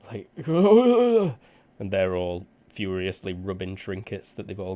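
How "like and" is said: high shrieking giggle; squealing wizard cackle. Acted. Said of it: "The wizard's kinda".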